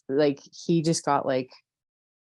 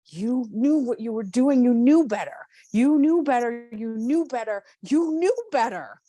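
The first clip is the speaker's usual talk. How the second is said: higher and higher, near a cry; escalating anger; 8 semitones higher than usual. Acted. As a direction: keep pushing until it's all out. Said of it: "Keep going until it feels like".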